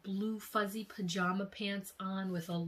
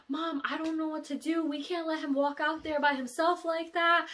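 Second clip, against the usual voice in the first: higher, worried plea; anxious pleading; 10 semitones higher, 7 dB louder than usual. Retold or imitated. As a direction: beg mom to act. Pleading with mom.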